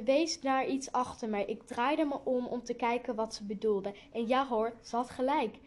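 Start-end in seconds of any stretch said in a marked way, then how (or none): none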